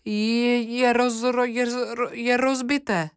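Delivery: high, halting, stuck on each start; frightened stammer. A narrator's reading. Cook telling the lady someone broke in, stammering. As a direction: fear trips her over the words.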